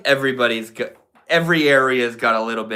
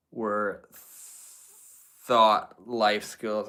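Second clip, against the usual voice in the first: ordinary talk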